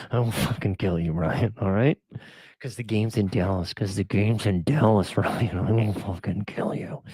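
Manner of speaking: low, mock-menacing threat. Joking to Ryan over the Dallas game, low mock menace. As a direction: pretend to be furious, half-laughing.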